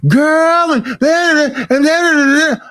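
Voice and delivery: high, mock hollering relative voice; imitating hollering relatives. Comic mimic of relatives yelling at family gathering, high mock holler. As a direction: ham up a relative shouting over the crowd.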